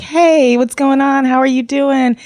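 high, cheery sing-song hello; mock bubbly greeting. Greeting an old school friend online, high and chirpy. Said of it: "and was like".

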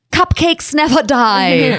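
mock-heroic cry in a Swiss accent, high and slow; Mercy. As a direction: proclaim something trivial as a battle cry. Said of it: "You can be like".

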